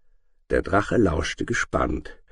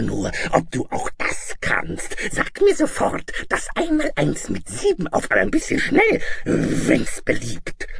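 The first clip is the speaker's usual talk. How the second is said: menacing dragon, loud and shrill; bullying dragon teacher; 5 dB louder than usual. Acted. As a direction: demand it like a tyrannical dragon. Dragon voiced in the story.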